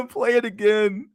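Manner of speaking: laughing. Real, not acted. Streamer asking to replay the clip, laughing. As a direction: still high and giggly, slowing down. Still laughing after the video.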